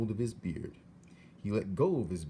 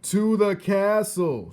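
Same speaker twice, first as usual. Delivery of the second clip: high, loud, slow shout; 12 semitones higher, 12 dB louder, slower than usual. Acted. Soldier shouting to hard-of-hearing Mig. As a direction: spell it out loudly for the deaf girl. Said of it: "shouted the soldier".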